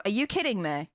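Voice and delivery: ordinary talk, nothing marked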